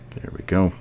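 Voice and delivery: normal talking voice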